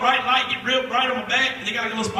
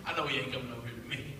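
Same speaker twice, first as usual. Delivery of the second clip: inner thought; 9 semitones lower, 11 dB quieter, faster than usual. Retold or imitated. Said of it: "thinking in my mind".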